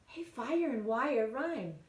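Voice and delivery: ordinary delivery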